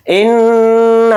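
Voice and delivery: इन्ना with the final ना drawn out long; stretched madd. Shown as a demonstration. Teacher demonstrating madd munfasil on इन्ना, drawn out. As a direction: hold the ना of इन्ना for three alif lengths. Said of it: "इस तरह से खींच कर पढ़ेंगे".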